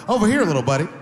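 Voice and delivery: coaxing call to the dog. Retold or imitated. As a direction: call the little buddy over sweetly. Calling the dog over.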